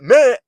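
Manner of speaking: "Minute" slurred high and loud; mock "Minute" with the middle swallowed; high, loud, mangled "Minute"; mocked mispronunciation. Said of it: "you don't know how to say minute".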